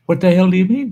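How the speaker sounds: high, indignant diner's comeback; indignant retort. Acted. Diner challenges the waitress who hushed him, indignant. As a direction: push the pitch up in disbelief.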